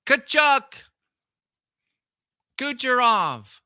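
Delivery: high, loud player-name call; mock hockey announcer. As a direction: bark the names like a commentator. Calling hockey names.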